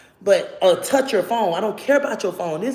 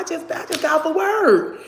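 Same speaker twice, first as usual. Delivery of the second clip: high protesting friend; 12 semitones higher, 4 dB louder than usual. Retold or imitated.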